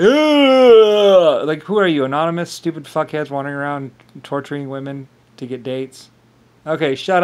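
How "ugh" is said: a loud, high, disgusted Ugh; loud disgusted groan. Retold or imitated. People reacting to him with a loud disgusted groan. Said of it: "other people are like".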